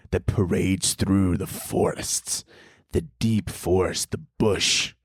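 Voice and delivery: slow hushed sultry narrator; a parody romance voiceover; mock trailer narration. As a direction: breathe it out like a steamy movie trailer.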